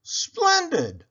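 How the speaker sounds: high delighted exclaim; delighted cow. Acted. Cow approves.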